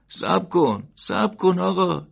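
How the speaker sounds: high, slow calling out; desperate shout to stop him; shouting after him. Acted. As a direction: call after someone who's leaving. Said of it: "بقال از پشت سر او داد زد".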